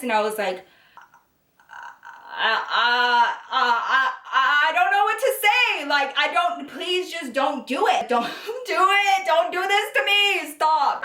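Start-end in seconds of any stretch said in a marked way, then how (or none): 2.3-11.0 high, loud stammered begging, retold; panicked pleading. Girlfriend begging him not to propose, panicked. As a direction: act out a frantic, wailing plea to stop. Acting out her panicked plea to stop.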